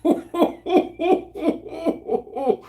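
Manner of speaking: long high run of laughter; helpless, real laughter in a steady string; real laughing fit. Real, not acted. Teller cracks up at his own story, real laughing fit.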